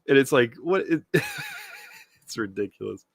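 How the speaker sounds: plain reading voice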